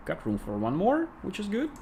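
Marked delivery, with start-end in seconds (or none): 1.2-1.7 mock English accent, high, rising on "good"; a silly English accent, "which is good" lifting up; hammed-up English accent; put-on English accent. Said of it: "rather silly attempt to perform some English-speaking kind of an accent".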